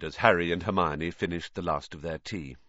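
ordinary delivery

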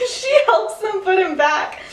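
said through laughter; laughing. Real, not acted. Telling a friend how Lily helped, laughing. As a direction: giggle through the line.

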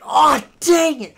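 high, loud, slow wail; pained cry. Retold or imitated. Hunting buddy wailing after another blast, high and loud. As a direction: howl it in pain. Blasted again without earmuffs.

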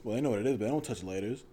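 normal talking voice

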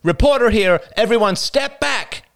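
loud, high public announcement. A narrator's reading. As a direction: call out a warning to the whole hall. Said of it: "raised his voice".

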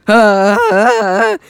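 flustered sounds of not understanding; confused ah ah. Retold or imitated. Jack baffled by French.

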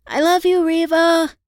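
loud, high call across the dock; a friend calling goodbye to the departing boat, loud yell. A narrator's reading. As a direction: shout it after her to the boat. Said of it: "I yelled out as they got on the boat".

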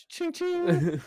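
high ching ching; cash register sound. Retold or imitated. Imitating purchase sound.

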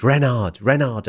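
a moderate mock shout. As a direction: call it out across the road. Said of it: "I shouted".

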